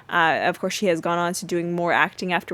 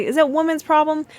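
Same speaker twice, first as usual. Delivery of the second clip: put-on much higher and louder, a little girl's voice; a hint of a little girl asking; kid's question; 8 semitones higher, 4 dB louder than usual. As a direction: pipe up like a curious child. Rudy the kid asks innocently.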